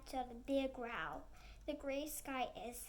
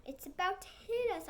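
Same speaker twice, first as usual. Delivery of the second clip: frightened cry; 6 semitones higher, 6 dB louder than usual. Acted. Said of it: "I cry".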